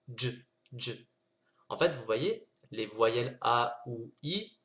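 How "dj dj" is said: dj, dj: consonne seule, sans voyelle; clipped dj sound. Shown as a demonstration. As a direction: couper net après le dj. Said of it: "ça se prononce tout simplement". (prononcer dj sans voyelle)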